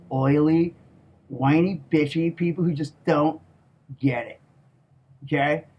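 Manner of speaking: usual speaking voice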